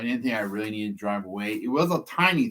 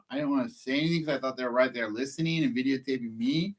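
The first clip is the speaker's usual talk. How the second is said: ordinary delivery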